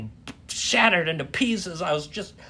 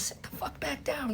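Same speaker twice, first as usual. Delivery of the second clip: hissed order; 11 semitones higher, 10 dB quieter than usual. Retold or imitated. Hissing at her in theater.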